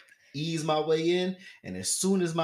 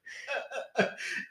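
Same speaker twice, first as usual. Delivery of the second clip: laughing; 12 semitones higher, 8 dB quieter than usual. Real, not acted. Laughs at penguin image.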